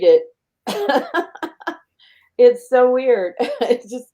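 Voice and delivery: emotional laugh. Real, not acted. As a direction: laugh it off as tears come. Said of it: "You obviously became emotional".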